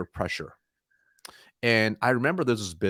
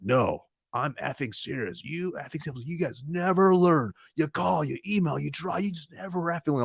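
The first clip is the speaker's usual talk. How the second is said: higher, restrained imitation of an angry man; mock angry rant; 6 semitones higher, 3 dB quieter than usual. Angry owner berating a cold-calling salesman, retold angry rant. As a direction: act out seething exasperation without raising the volume. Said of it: "he starts getting angrier and angrier".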